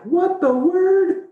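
squeaky high falsetto gasp of disbelief; shocked disbelief. Retold or imitated. Husband retelling his shock at the news, squealed high. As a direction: blurt it out way up high, stunned. Shocked exclamation after removal.

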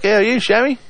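imitating a customer's cheerful hello; cheery greeting. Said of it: "He's like".

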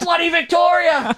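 high, drawn-out heckler's yell; mock outraged shout. Host plays a listener heckling the guest, outraged shout. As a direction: holler it like an indignant fan.

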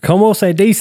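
high, mock Spanish-accented question; imitated Spanish-speaker voice; put-on Spanish accent. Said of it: "I also do it with the Spanish-speaking folks".

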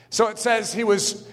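normal talking voice

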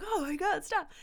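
high-pitched mock panic; alarmed plea. Dopey friend begging the mean guy to quit, high alarm.

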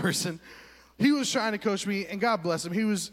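ordinary talk

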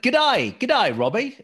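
high, loud mock Aussie G'day; hammed-up Australian greeting, G'day drawn out; Aussie accent. Greeting co-host Robbie in a jokey Aussie accent. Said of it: "If I wanna say hello in an Aussie accent".

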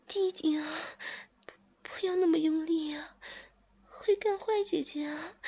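narrated woman crying out in pleasure. A narrator's reading. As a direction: cry out in ecstasy, slowing on the long moans. Narrated sex scene, heavy moaning.

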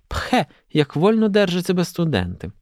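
high-pitched schoolgirl scorn; a young lady's haughty pooh, pitched high; scornful exclamation. A narrator's reading. Olha to her mother about lounging students, scornful.

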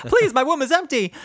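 high, loud, hammed-up begging; mock desperate plea. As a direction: beg in comic desperation. Mock plea of eager girlfriends.

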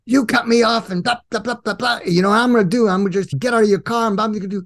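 hammed-up road-rage rant; angry pickup driver. Truck driver yelling at the man who cut him off, mock angry. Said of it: "he says".

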